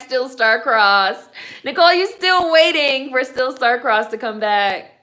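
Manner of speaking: loud, high singsong tease; teasing a fan who still waits for her show. Real, not acted. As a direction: rib the viewer, drawing out the words. Teasing a viewer about her show.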